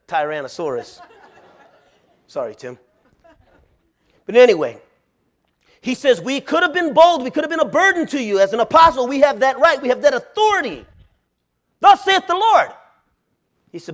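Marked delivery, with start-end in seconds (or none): 6.2-12.7 loud, high, thundering authority; hammed-up fire-and-brimstone boom; bold domineering preacher. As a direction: bellow it like a bossy prophet.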